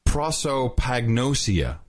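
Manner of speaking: "Prosopagnosia" sounded out slowly; careful retry. Real, not acted. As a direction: take "Prosopagnosia" slowly, piece by piece. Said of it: "let me try it again".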